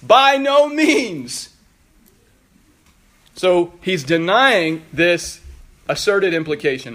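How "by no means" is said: "By no means" slow, high and loud; emphatic exclamation. Acted. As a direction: cry out "By no means" as a drawn-out, absolute denial. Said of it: "gonna have an exclamation point".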